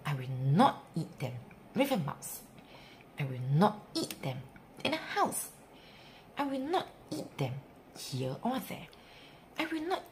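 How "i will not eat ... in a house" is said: usual speaking voice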